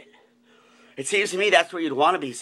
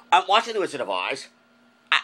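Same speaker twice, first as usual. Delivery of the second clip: normal talking voice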